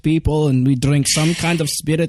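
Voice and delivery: hammed-up Eastern European comrade; Eastern European accent. Mocking generic audition requests, hammed-up Eastern European accent. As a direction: play a war-hardened comrade, thick Eastern European accent. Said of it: "Eastern European".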